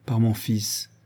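ordinary delivery, nothing marked

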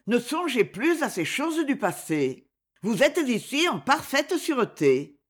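ordinary delivery

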